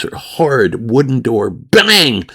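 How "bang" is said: loud bang. Retold or imitated. Teller acting out the slam, loud and high. Door slam sound effect in story.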